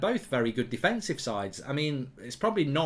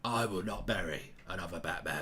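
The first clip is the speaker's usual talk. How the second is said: low, hushed Cockney impression, fading away; Michael Caine; 8 semitones lower, 5 dB quieter than usual. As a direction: sink into a soft mock-emotional Cockney plea. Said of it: "I can't do Michael Caine".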